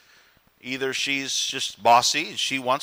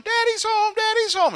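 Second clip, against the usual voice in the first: put-on much higher squealing kid voice; excited kids. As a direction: play the kids cheering at the door. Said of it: "instead of the kids going".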